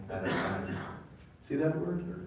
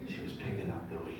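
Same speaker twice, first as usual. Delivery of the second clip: softly whispered, moved; hushed; 6 semitones higher, 9 dB quieter than usual. Real, not acted. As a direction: breathe it out in a whisper. Tender moment in wedding story.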